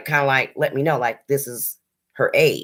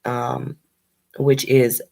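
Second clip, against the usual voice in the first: ordinary talk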